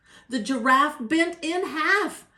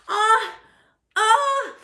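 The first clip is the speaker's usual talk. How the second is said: high, loud "ah" before a sneeze; climbing pre-sneeze ahs; sneeze build-up; 8 semitones higher, 7 dB louder than usual. A narrator's reading. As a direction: gasp up toward a huge sneeze.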